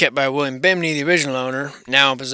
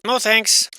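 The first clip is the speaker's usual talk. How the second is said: high and louder, a flat dismissal; emphatic no thanks; 8 semitones higher, 4 dB louder than usual. Real, not acted. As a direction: push the room away with a blunt refusal. Rejecting a creepy room.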